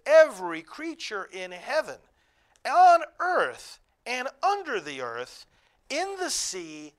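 exultant loud reading of scripture; crying out in praise. Acted. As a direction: call it out as if all creation sings. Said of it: "was crying out".

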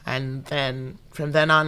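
normal talking voice

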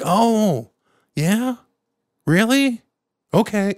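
placating fix-it voice. Retold or imitated. Him reacting to sick boy.

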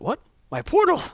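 squeaky high shocked cry; a jump in pitch, caught off guard; shocked demon. Retold or imitated. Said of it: "He went".

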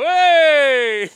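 high, loud barked hey; a guy hailing his buddies as one walks in, loud; guy's loud hey. Retold or imitated. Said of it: "We just go".

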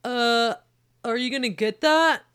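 calling loudly from another room; husband calling out. Acted. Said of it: "John called from the next room".

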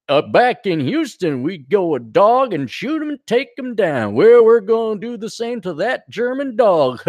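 folksy mock Texas monologue; Texas dope. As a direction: narrate like a corny Texan war hero. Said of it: "the good old American Texas dope".

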